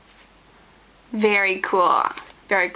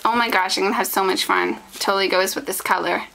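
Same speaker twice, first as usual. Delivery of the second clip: usual speaking voice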